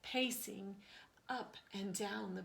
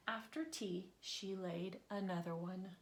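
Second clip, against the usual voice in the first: ordinary delivery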